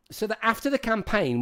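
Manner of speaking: normal talking voice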